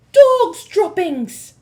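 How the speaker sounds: slow, loud, high yell. Acted. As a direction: yell it in disgust. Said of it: "she yelled".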